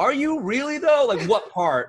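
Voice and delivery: high, mock-incredulous questioning; imitating a suspicious gamer; skeptical strangers. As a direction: poke holes in the claim, mock disbelief. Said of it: "they'd be like".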